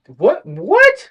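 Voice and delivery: reacting to the groom going through with it, stunned; stunned disbelief. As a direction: climb into shocked disbelief. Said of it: "I'm like".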